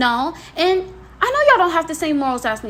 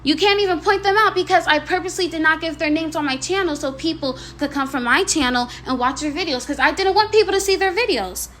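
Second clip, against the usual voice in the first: ordinary talk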